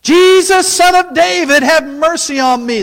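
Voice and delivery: high, loud desperate plea; crying out. Acted. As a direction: shout it, begging for mercy. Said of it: "he's standing up now".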